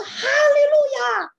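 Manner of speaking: high, loud, slow shouted praise. Shown as a demonstration. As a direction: shout it out in the car. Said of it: "我就大声的shouting".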